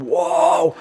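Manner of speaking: loud, high mock "whoa"; amazed kids' cry. Kids running over to gawp at the sunburnt girl, amazed. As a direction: imitate wide-eyed kids shouting in amazement.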